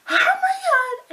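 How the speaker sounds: very high, loud drawn-out wail; retold freak-out. Fan acting out his freak-out, high mock-panicked wail. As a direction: imitate him wailing in panic. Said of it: "freaking out".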